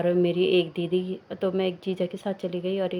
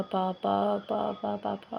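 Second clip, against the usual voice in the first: softer, tearful calls of papa, lightly acted; retold crying; 3 dB quieter, much slower than usual. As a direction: call for papa as if starting to cry, understated. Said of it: "पापा करते करते मैं रो रही थी".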